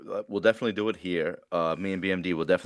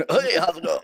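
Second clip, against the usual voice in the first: high, put-on radio greeting with a laugh; mock a cheesy radio host, pitched way up; fake radio voice; 8 semitones higher, 4 dB louder than usual. Host mimicking Andrew's greeting, fake radio voice. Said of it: "That was fucking so fake radio voice".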